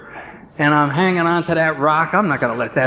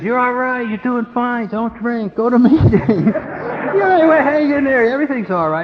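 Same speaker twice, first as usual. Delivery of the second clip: lightly voiced reassuring God, a touch higher; 5 semitones higher than usual. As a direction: gently reassure like a kind father. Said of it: "He says".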